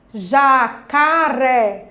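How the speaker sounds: "Jacaré" very slow, ja-ca-ré; separated syllables. Shown as a demonstration. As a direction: hold each syllable of "Jacaré" apart. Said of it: "Ó, separe". ("Jacaré" separado em sílabas, bem devagar)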